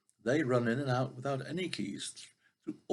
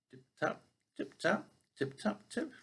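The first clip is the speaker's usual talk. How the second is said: tapping sound; 7 semitones higher than usual. Acted.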